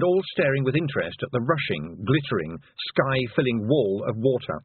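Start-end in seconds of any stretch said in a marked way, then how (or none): none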